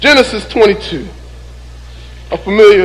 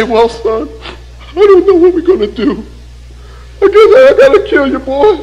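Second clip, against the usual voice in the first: higher, lightly hammed-up defeated father; mock hopeless Abraham; 5 semitones higher than usual. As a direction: play a doubting father for laughs. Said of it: "Abraham didn't say".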